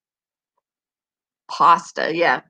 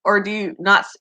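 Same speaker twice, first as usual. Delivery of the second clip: normal talking voice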